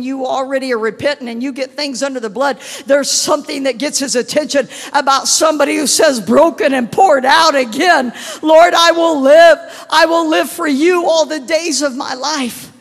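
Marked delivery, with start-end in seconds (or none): 2.8-12.7 very loud, high cry of devotion; impassioned preaching. Real, not acted. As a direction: shout the vow to heaven. Preaching climax, vow to Lord.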